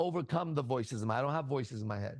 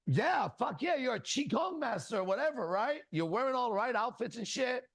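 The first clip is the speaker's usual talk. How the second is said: high-pitched, mocking hype; mock enthusiasm; 8 semitones higher than usual. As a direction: play along with over-the-top approval. Mock hype for fake qigong master.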